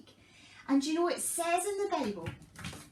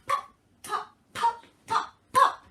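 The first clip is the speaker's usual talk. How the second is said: loud pops; 7 semitones higher, 7 dB louder than usual. Acted. Popping builds with shouting.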